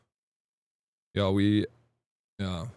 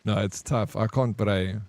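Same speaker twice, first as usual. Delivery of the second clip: ordinary talk, nothing marked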